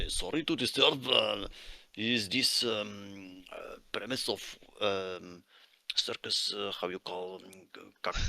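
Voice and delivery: halting, polite foreign worker asking the way; Russian accent. Acted. Plays Russian-accented miner NPC.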